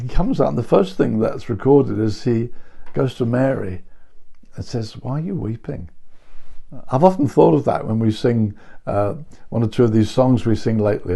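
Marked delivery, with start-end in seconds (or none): 5.0-5.9 soft, gentle asking; a quiet, caring question; tender question. Acted. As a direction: lean in kindly to a weeping woman. Jesus gently asks weeping Mary.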